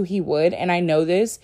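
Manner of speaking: usual speaking voice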